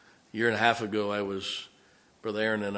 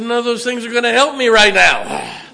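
high, loud retold outburst; hurt man yelling at his friend to stop talking; exasperated shout; 11 semitones higher, 14 dB louder than usual. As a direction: bark it back at him, much higher and louder. Retold exasperated reply in pain.

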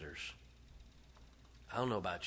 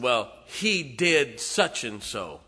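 slow and louder, a mock accusing devil; gloating devil; 3 semitones higher, 10 dB louder, much slower than usual. Preacher acting the devil tattling to God, slow, louder mock accusation. Devil voiced in sermon story.

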